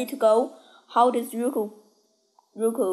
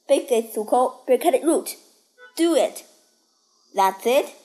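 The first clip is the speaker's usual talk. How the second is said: urging shout; 3 semitones higher, 4 dB louder than usual. Acted. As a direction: push him on, building to a yell. Coaching during a duel.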